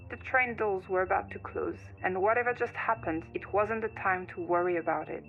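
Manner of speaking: plain reading voice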